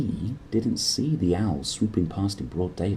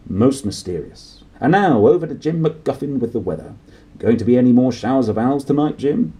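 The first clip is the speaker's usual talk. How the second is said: hint of a chirpy newsreader, louder; 4 semitones higher, 6 dB louder than usual. Newsreader hands over to Jim, a hint of chirpy. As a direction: hand over brightly to the weather.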